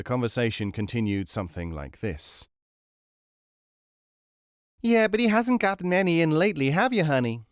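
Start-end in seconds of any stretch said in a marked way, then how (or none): 4.8-7.4 brash American tourist nudging her friend; American woman. A narrator's reading. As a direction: chatter it like a brash American shopper. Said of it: "said another".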